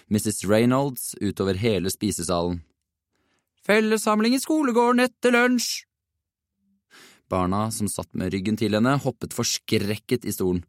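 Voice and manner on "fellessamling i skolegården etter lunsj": very high, piercing monotone call; shrill announcement. A narrator's reading. Deputy head calls to the whole dining hall, shrill. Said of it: "lød den skingrende stemmen til inspektør to".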